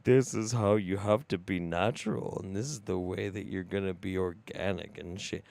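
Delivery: low-pitched mock guru voice; mocking diet influencer. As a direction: drop low to parody a natural-food preacher. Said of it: "And you're like".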